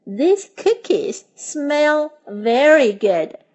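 slow, clear teaching read; teacher modelling a sentence slowly; slow model sentence. Shown as a demonstration. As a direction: say it slowly for learners to repeat. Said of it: "Now let's learn some sentences".

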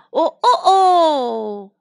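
rooster crow. Acted. Imitating the cockerel crowing.

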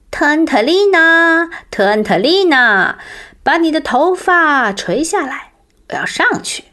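calling up loudly, high. Acted. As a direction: shout up to the tree. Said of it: "像平时一样大声叫道".

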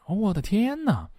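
very high, dramatic "oh my god"; dismayed exclamation. Acted. Teacher translating "oh my" for learners, dismayed exclamation. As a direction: exclaim in mock shock.

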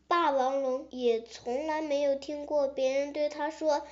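ordinary delivery, nothing marked

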